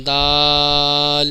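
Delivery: دال very slowly, long aa closing on l; correct pronunciation. Shown as a demonstration. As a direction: stretch دال and sound the final l. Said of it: "اینڈ میں لام کی آواز نکلے گی".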